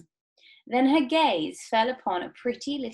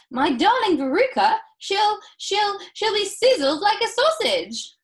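high, loud hysterical mother; frantic, piercing, spluttering outburst; freaking out; 13 semitones higher, 10 dB louder than usual. A narrator's reading. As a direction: stammer and wail in full panic. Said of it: "freaking out".

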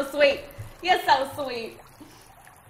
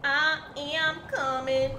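slow drawn out; 3 semitones higher, much slower than usual. Pretend.

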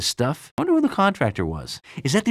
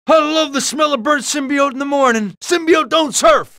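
gung-ho war-film parody; 10 semitones higher, 4 dB louder than usual. Acted. Mock war-film quote while fighting.

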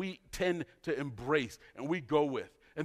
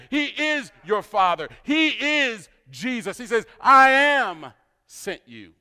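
full-force preaching; 4 semitones higher, 8 dB louder than usual. Acted. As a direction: preach it harder with each title, stretching the key words.

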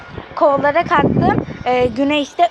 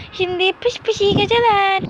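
put-on higher, cooing goodnight to a baby; talking to a baby; 6 semitones higher, 4 dB louder than usual. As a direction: coo the baby to sleep. Putting the baby doll to sleep.

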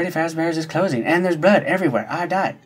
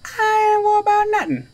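high mock carefree; 14 semitones higher than usual. Repeated high and sung out.

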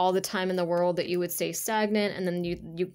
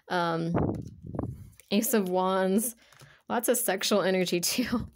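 ordinary delivery

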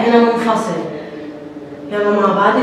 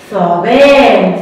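ثعبان stretched very slowly; slow model word; 2 semitones lower, 3 dB louder, much slower than usual. Shown as a demonstration. Teacher to children, modelling ثعبان very slowly. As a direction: draw out every sound of ثعبان. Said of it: "ثو".

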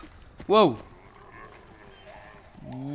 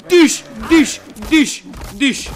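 high, loud squeaky stabbing sounds; dish dish stab noise; 11 semitones higher, 13 dB louder, much slower than usual. Pretend. Gamer voicing his knife stabs, high and loud. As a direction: chant the stab noise like a cartoon.

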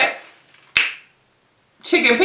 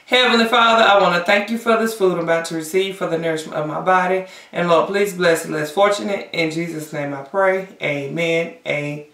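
saying grace; 4 semitones lower than usual. Real, not acted. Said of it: "I gotta say my grace".

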